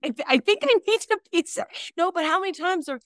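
talking through laughter, high; giggling the words out; laughing. Real, not acted. Laughing while joking.

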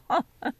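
light amused laugh; a quick embarrassed chuckle; short laugh. Real, not acted. Laughs at own yawning.

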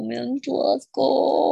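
hammed-up crying disgust; mock disgusted whine. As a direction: wail it like it physically hurts. Repeats disgust, exaggerated whine.

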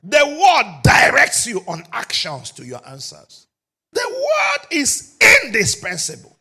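loud, high pulpit cry; preaching at full force. Acted. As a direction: proclaim it to the hall. Preaching climax.

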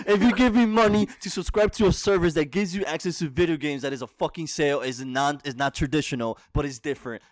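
heated wrestling promo rant, loud. Acted. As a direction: cut a fired-up heel promo. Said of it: "I cut a promo".

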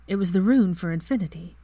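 ordinary delivery, nothing marked

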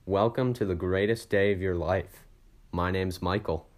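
big energetic show opening; loud intro. Real, not acted. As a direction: shout the welcome like a host. Podcast host opening shout.

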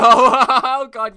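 loud, high burst of laughter on oh; a real laughing oh; laughing exclamation. Real, not acted. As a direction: crack up loudly at the joke. Laughing burst at cohost's hatred.

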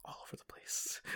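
breathy whispered aside. Acted. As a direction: whisper it, barely audible. Whispered joke.

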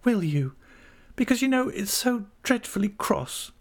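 put-on high, childlike pleading; coaxing. A narrator's reading. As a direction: wheedle him, pitched well up. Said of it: "coaxed Dora".

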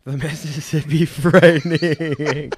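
laughing through the words; cracking up mid-phrase. Real, not acted. Repeats the pun, cracking up.

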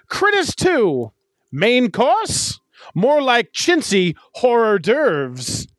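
milk the pun like a 1940s Transatlantic announcer; old-timey transatlantic accent. Acted. Pitching her favorite Critters 2 tagline in an old-timey Transatlantic accent. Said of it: "keep with my old-timey voice".

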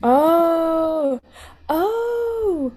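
long, drawn-out high ohs of discovery; real amazement. Real, not acted. Podcast guest sees the obscure instrument's real spelling, genuinely amazed. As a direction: let the astonishment dawn slowly, then puzzle aloud. Reacting to finding the odd instrument.

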